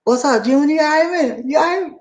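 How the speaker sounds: mock tough inmate sizing him up. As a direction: inmate teasing the boy. Inmate greets the boy.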